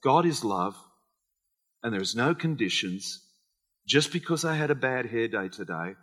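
quiet personal confession. Retold or imitated. Believer quietly affirming God's love, soft and inward. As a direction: murmur it like a private prayer. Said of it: "when you can say".

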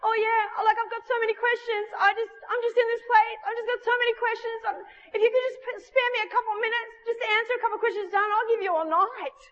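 put-on high, hurried voice of a pleading girl; eager questioning girl. Girl begging the preacher to answer her many questions, high and eager. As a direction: imitate an eager girl, words tumbling out high. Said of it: "this girl's going".

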